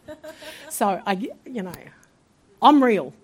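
usual speaking voice

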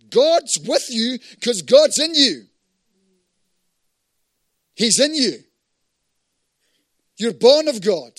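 loud, high proclamation; forceful preaching. Real, not acted.

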